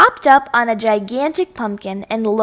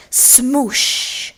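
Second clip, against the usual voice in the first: whispered sound word. A narrator's reading. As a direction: breathe the word out with no voice. Pumpkin smashes.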